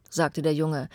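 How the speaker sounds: plain reading voice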